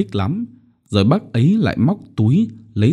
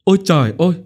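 high-pitched startled exclamation; narrated shriek, kept fairly contained; startled cry; 9 semitones higher, 3 dB louder, much faster than usual. A narrator's reading. Mother jumping at someone behind her, contained startled cry. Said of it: "bỗng giật mình kêu thét lên".

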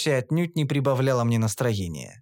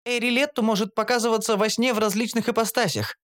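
higher, informative female voice; explaining Shantri; 8 semitones higher than usual. A narrator's reading. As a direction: explain the goddess patiently. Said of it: "пояснила Шантри". (Шантри объясняет высоким голосом)

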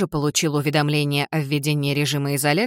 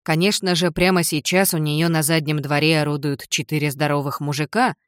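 ordinary delivery, nothing marked

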